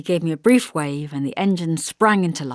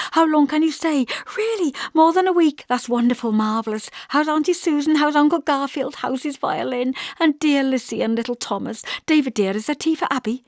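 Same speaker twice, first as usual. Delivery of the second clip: high, eager stream of questions; chattering Hester; 7 semitones higher than usual. A narrator's reading. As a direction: pour out every question at once.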